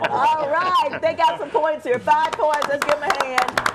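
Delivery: rally the crowd for points; excited host. Real, not acted.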